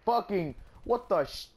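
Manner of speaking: loud, high sputtering rage; furious yell. Real, not acted. Gamer cursing the locust enemy, furious yell. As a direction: spit real fury at the enemy.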